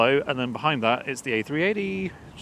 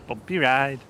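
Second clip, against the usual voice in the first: light Jamaican accent, a bit louder; 4 dB louder, slower than usual. Shown as a demonstration. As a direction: say it the Jamaican way, easy and sing-song. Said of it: "I always feel like saying that in a Jamaican accent".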